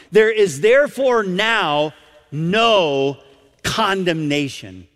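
slow, emphatic "no", then a pause before "condemnation". Retold or imitated. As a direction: punch "therefore", stretch "no", let "condemnation" settle. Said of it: "with such an emphatic tone".

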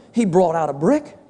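ordinary talk, nothing marked

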